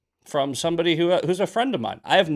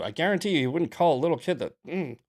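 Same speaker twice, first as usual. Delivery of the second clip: ordinary talk, nothing marked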